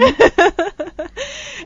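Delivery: long high giggle; high laughter. Real, not acted. Joking about island getaway trips.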